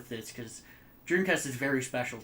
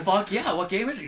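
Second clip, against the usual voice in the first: higher, laughing, eager reply; excited kid; 7 semitones higher, 6 dB louder than usual. Retold or imitated. A kid invited over to try a new game, excited and laughing. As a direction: jump at the invite.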